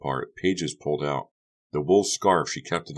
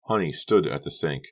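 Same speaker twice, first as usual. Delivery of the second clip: ordinary delivery, nothing marked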